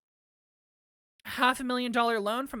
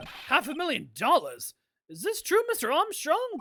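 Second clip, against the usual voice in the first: higher, swooping disbelieving questions; shocked courtroom questioner; 6 semitones higher, 3 dB louder than usual. Acted.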